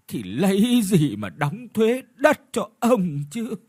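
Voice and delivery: put-on high, pleading old woman's wail; begging old woman. Old woman begs the landlord to spare her, pleading. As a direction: beg for mercy, voice straining. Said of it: "mặc cho bà cụ van xin".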